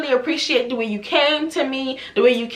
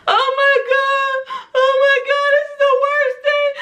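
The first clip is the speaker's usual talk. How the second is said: mock bawling; 13 semitones higher, 4 dB louder than usual. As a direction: imitate a client bawling over a disaster. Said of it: "she literally bawls out crying".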